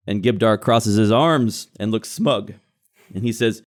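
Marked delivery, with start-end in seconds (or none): none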